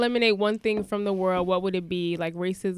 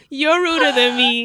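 loud laughing imitated retort; hammed-up teasing outburst; laughing mock shout; 4 semitones higher, 10 dB louder than usual. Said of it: "And I was like".

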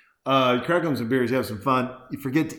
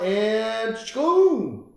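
swooshing blade noise; 8 semitones higher, 4 dB louder than usual. Pretend. Sound effect for the blade.

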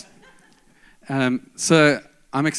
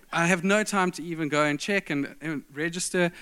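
usual speaking voice

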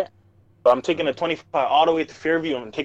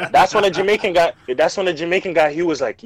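ordinary talk, nothing marked

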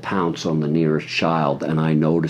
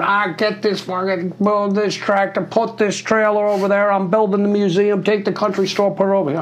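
much higher put-on voice rattling off orders; hammed-up boss firing off chores, pitched way up; imitating Michael; 14 semitones higher than usual. Michael bossing everyone around the farm, high mock voice. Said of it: "and he's going".

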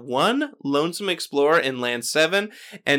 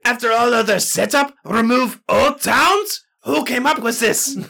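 put-on French accent; 6 semitones higher than usual. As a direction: read it in a hammy French accent. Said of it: "That's my French".

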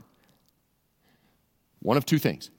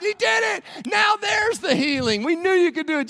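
much higher, shouting in triumph; celebrating crowd; 4 dB louder than usual. Retold or imitated. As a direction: cheer like fans chest bumping. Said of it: "they're high-fiving and they're chest bumping".

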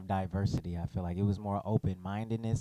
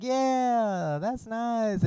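high mock voice; 12 semitones higher, much slower than usual. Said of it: "if he was like".

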